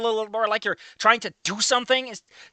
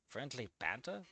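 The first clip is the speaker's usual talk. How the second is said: much lower and softer, rising disbelief; incredulous repeat; 9 semitones lower, 21 dB quieter than usual. Real, not acted. Reviewer scoffing at the fic's excuse, incredulous. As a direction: repeat it as if you can't believe it.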